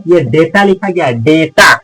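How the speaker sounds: normal talking voice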